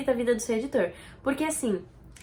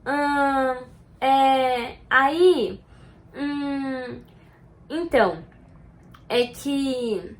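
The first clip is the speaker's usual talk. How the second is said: hesitant ahn, hum fillers with long gaps; filler sounds; 4 dB louder, much slower than usual. Shown as a demonstration. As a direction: stumble through verbal tics on purpose. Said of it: "evite manias. Sabe aquelas". (demonstrando manias de fala hesitantes)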